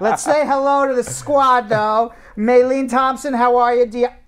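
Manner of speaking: loud, high, drawn-out greeting; calling out. Real, not acted. Host greeting the squad and a viewer, calling out. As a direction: project the hello to the whole squad.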